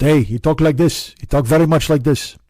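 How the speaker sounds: rough imitation of a Swedish neighbor's accent; Oscar's accent. Shown as a demonstration. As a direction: put on a foreign accent, supposedly Swedish. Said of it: "I won't do Oscar's accent, but he's from Sweden".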